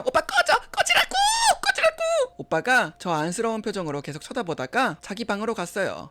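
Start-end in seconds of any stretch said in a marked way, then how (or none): 0.0-2.0 very high, loud screamed rejection; screaming get lost. Acted. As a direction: scream at him to go away. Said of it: "계속 밀쳐냈어요".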